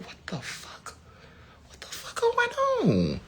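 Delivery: high, strained mock confusion; bewildered onlookers. Imitating people around a panicked person, high and bewildered. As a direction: play baffled bystanders. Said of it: "they're like".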